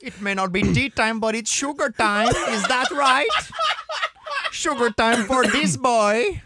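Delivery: high, loud hammed-up Dutch voice; gloating Dutch player mocking the Brit; loud Dutch accent. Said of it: "faire un accent hollandais".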